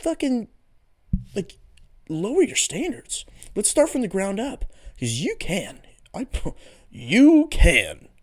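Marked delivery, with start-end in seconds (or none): 6.1-8.1 slow, drawn-out words through a laugh; cracking up mid-sentence. Real, not acted. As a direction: laugh while stretching the words out. Laughing repeat.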